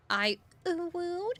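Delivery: high, slow cutesy uwu. Retold or imitated. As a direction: coo it like a baby-talk uwu. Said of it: "in obnoxious uwu voice".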